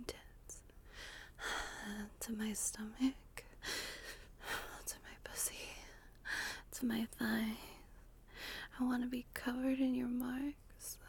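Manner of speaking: breathy close-mic ASMR whisper; intimate whisper. Acted. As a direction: whisper it right into the listener's ear.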